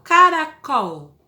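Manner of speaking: Caracol said very slowly, tongue on the teeth for the final L; soft final L. Shown as a demonstration. Teacher slowly models the final L for her pupils. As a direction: stretch out Caracol and soften the L at the end. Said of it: "ele prende a língua lá no dente".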